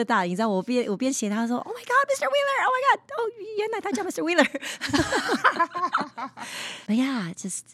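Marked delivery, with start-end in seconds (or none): 1.6-4.4 much higher squeal of recognition; excited exclaim. Retold or imitated. Writer recalls finding her teacher's name, squealing excitedly. 4.5-6.8 a higher, giddy laugh; high delighted laugh. Real, not acted. Guest laughs after recalling the discovery, giddy.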